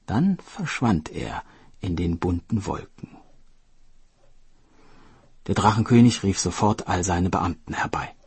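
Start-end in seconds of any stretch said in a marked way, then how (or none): none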